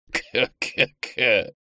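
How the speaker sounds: slow, eerie mad cackle; strange cackle. Acted. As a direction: cackle like a madman. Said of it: "начал странно смеяться".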